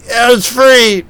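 high, drawn-out protest; slow, high-pitched mock defence; defensive retort. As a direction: mimic a drunk friend's whiny excuse. Pete's retort in the quarrel.

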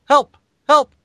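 high, squeaky little yelps; tiny comic high-pitched pleas; mock cry for help. Voicing the second guy in the panel, high mock yelps. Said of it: "Second guy says".

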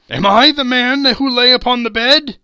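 high, loud pleading cry; anguished cry. A narrator's reading. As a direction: cry out as if begging on your knees. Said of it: "he cried upon his knees".

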